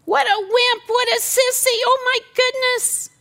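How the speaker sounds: high, loud sneering voice; mocking scoff. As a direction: jeer at him as a sissy.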